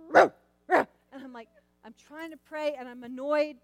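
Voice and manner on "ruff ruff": loud, high imitated dog barks; copying the dog's barking; loud mock bark. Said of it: "And he's".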